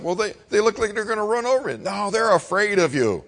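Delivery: lightly voiced scared boy; nervous boy. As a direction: rush out the excuse. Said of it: "I said".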